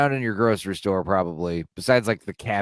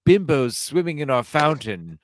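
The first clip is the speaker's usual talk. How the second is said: stuffy hotel manager voice, louder; mock hotel staff; 3 semitones higher, 4 dB louder than usual. Friends joking about a Batman movie scene, prim hotel manager voice. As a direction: play the prim manager scolding a guest. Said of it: "Bruce Wayne is like".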